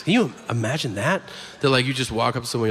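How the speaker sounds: ordinary delivery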